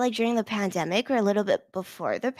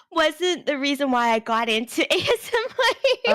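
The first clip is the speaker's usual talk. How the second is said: words climbing into giggles; laughing confession; 3 semitones higher than usual. Real, not acted.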